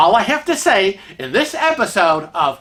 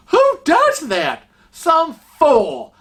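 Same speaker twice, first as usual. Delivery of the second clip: indignant; 10 semitones higher, 3 dB louder, slower than usual. Real, not acted. Indignant rhetorical outburst.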